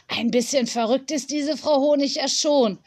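ordinary delivery, nothing marked

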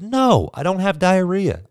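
mock outrage at the mix-up; exasperated yell. Patient yelling at the doctor about the wrong diagnosis, exasperated.

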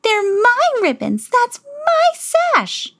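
very high, slow outraged shrieks; shrieking stepsisters. Acted. Stepsisters shriek at Cinderella's dress, high and outraged. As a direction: screech it like spoiled sisters. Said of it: "The stepmother shrieked".

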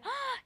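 high, soft gasp of shock; imitated startled intake of breath; gasp de asombro. Retells a startled woman's gasp. (grito ahogado de asombro imitado)

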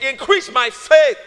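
boldly leading the church's response. Shown as a demonstration. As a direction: proclaim it with force. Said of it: "Say it boldly".

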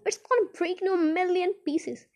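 high-pitched panicky warning; alarmed protest. A narrator's reading. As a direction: warn them in a high, worried voice. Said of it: "shouted Grandpa Joe".

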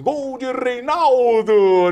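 high, loud, slow goal call; mock narrator. TV narrator calling the goal, loud and stretched. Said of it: "o narrador da Sport T V fala assim".

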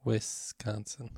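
slow, careful WIS in Wisconsin; corrective pronunciation. Shown as a demonstration. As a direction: teach Wisconsin slowly with a short i. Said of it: "You're saying Wes".

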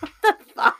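high startled yelp then laugh; shrieking surprise. Real, not acted. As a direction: squeal it out.

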